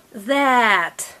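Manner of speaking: "that" drawn out long; said slowly. Shown as a demonstration. Teacher modelling a word for a child, said slowly. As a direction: stretch "that" out slowly. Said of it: "We're saying them slowly".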